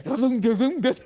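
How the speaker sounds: high voiced thumping noise, da-dum; imitated tire thumping. Retelling the U-Haul tire noise, mock thumping. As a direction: mimic a blown tire banging along. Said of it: "and it's like".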